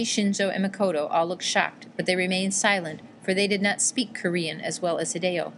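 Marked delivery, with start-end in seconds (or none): none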